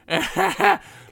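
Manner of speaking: high, loud triumphant cackle; gloating high laugh. Retold or imitated. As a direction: cackle like you just won.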